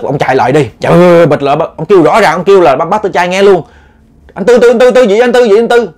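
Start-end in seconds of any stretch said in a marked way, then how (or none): 4.3-5.9 high, loud repeated calling of a name; urgent calling. Retold or imitated. Neighbor running over, calling the uncle loudly and urgently. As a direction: call him over and over, in a hurry. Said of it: "ông kêu liên tục".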